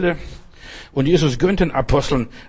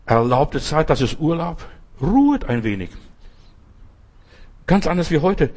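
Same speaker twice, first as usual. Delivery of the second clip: ordinary talk, nothing marked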